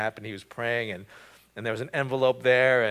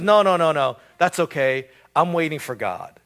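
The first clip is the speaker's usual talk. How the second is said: insistent refusal; 4 semitones higher, 9 dB louder than usual. Retold or imitated. Drowning man refuses the rowboat.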